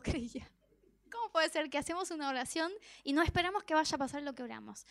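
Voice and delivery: normal talking voice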